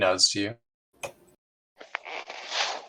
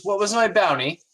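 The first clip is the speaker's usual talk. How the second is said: much higher first mate voice; first mate asking; 10 semitones higher than usual. Acted. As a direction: ask the bounty plainly curious. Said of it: "he says".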